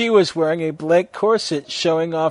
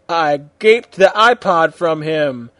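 ordinary delivery

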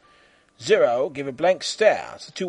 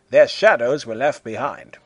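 usual speaking voice

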